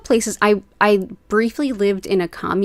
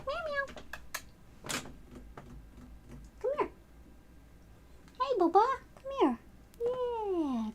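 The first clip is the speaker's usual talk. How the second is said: pet talk; 9 semitones higher, 5 dB quieter than usual. Real, not acted. Coaxing her cats Hattie and Bubba over, very high soft baby talk. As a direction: coax the kitty over in a high sing-song.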